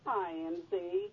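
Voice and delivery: distant echoey phone line; talking from across the room on speaker; on speakerphone. Real, not acted. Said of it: "You sound like you're on a speakerphone".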